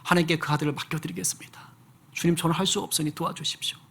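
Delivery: hushed repentant whisper; whispered prayer. Retold or imitated. Father repenting before God, whispering. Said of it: "하나님 앞에 회개하고".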